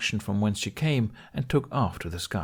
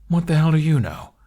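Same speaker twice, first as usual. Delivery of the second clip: louder, higher What the hell; angry retort; 8 semitones higher, 8 dB louder than usual. Acted. Toothless lashing out at Hiccup, loud and angry. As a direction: snap it at Hiccup.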